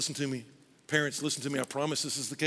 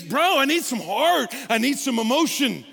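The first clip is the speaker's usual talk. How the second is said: exasperated wife; 9 semitones higher, 8 dB louder than usual. Retold or imitated. Wife begging husband for emotion, high and loud. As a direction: plead for feeling, mock wife. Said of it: "what she would say is".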